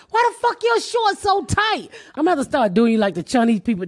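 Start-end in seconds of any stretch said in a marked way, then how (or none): 0.1-1.9 higher, slow shouted question; mock yell. Mom yelling at son over tight shorts, slow and high. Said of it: "I'm like".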